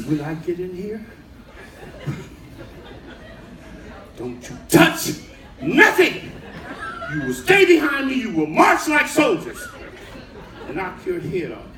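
stern orders, punched out after long pauses; mother's warning speech. Retold or imitated. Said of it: "We used to get a speech".

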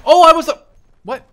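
loud, high yelp of shock; sudden startled yell. Real, not acted. Gamer hit mid-sentence, startled yell. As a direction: cry out as you're hit.

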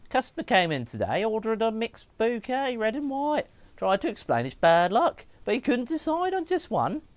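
lightly voiced note reader. Streamer reading a florist's note to chat, lightly voiced.

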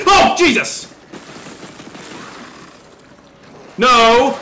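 loud, high cry of alarm; high burst of shock; startled yell. Real, not acted. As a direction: shout it as if caught off guard. Startled by game attack.

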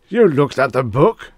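sharp suspicious cry; sudden suspicion. A narrator's reading. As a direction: catch him out in shock. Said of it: "cried Mister Demise with sudden suspicion".

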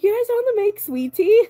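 high, loud incredulous squeak on sweet tea; shocked disbelief. Retold or imitated. Telling chat how she reacted to no sweet tea, incredulous. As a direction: act stunned that there's no sweet tea.

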